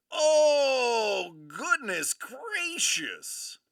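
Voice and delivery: high, loud, drawn-out amazement; excited exclamation. Real, not acted. Host reacting to another big card, excited exclamation. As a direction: draw it out in loud astonishment.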